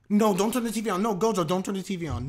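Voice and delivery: loud, high, urgent protest; alarmed pleading. Real, not acted. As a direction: shout at the screen to stop him. Pleading with character on screen.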